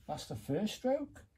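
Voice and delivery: a high, surprised question; sudden disbelief at the first stroke. Real, not acted.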